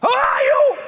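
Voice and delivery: booming arrogant alien overlord. Acted. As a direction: bellow the question at them. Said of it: "Richtofen as Oz".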